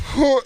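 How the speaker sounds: high choked sob sound; a little kid's sob caught in the throat; mock child sob; imitated sob. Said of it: "and I started crying".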